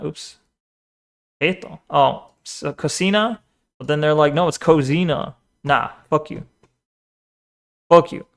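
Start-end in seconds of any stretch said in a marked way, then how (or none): none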